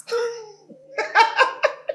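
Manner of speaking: high loud burst of laughter; loud high laugh at a friend's story. Real, not acted. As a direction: crack up helplessly. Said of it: "why are you laughing now".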